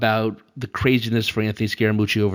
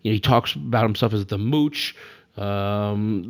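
normal talking voice

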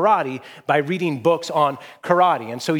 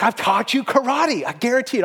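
higher-pitched, emphatic assurance; lightly voiced master; 7 semitones higher than usual. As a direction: lightly act a teacher insisting on his claim. Acting the master's triumphant assurance.

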